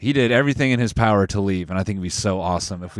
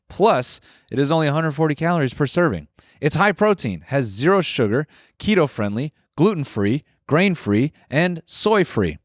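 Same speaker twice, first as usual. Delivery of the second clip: upbeat, cleanly paced podcast ad read; sponsor ad read; 3 semitones higher than usual. Real, not acted. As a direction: sell it to the listeners, lifting each selling point.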